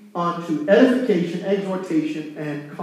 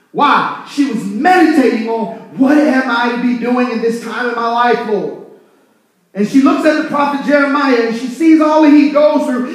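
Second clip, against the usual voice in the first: loud, raised-pitch sermon delivery; full-force preaching; 6 semitones higher, 9 dB louder than usual. Acted. Preacher relaying a church mother's discovery to the congregation, loud, impassioned preaching. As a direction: drive each phrase home like a fired-up preacher.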